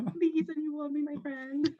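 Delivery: high, drawn-out joking plea; mock pleading. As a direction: beg for company in a high, slow, playful voice.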